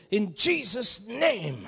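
slow, emphatic mock prayer voice; mock authoritative prayer. Shown as a demonstration. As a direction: ham up a forceful prayer. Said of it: "Emphasis on the right syllable".

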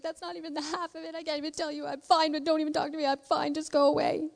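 high-pitched, hurried tearful babble; mock crying. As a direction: rattle it off as an overwrought woman in tears. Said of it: "Like, crying so hard".

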